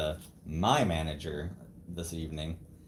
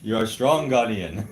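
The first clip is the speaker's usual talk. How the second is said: loud, rousing mock African accent; hammed-up African-accented praise; 3 semitones higher, 11 dB louder than usual. Husband stirring his wife's morale, loud mock African accent. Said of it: "I use my African voice".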